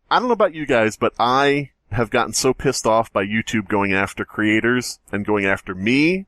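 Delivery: ordinary delivery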